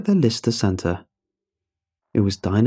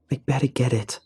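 clenched teeth; 3 semitones higher than usual. A narrator's reading. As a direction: force it out through tight teeth. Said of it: "between clenched teeth".

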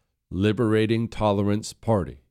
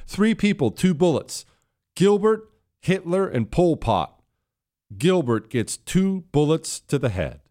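pitched up, hammed-up reading of the threat; mock outraged quote; 7 semitones higher than usual. Host mocking the texter's violent words, hammed up and higher. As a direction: repeat the quote as a mocking, raised-pitch impression. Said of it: "and I quote".

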